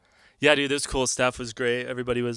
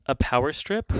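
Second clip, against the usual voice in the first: usual speaking voice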